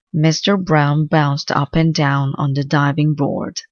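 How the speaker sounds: ordinary delivery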